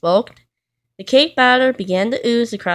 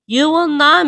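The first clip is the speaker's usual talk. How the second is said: higher, firm storybook shout; 7 semitones higher, 4 dB louder than usual. A narrator's reading. Mister Stubborn forbids Mister Messy the oven, shouting high. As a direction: snap a flat no, pitched up. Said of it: "Mister Stubborn shouted".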